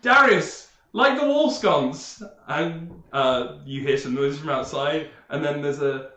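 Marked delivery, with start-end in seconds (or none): 0.0-2.2 high, loud, slow order to a servant; lordly command. Acted. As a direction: call out to the guard grandly. Knight orders guard.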